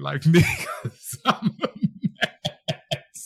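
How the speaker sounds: words dissolving into real laughter; laughing. Real, not acted. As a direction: crack up at yourself, losing the last word. Cracks up at own confession.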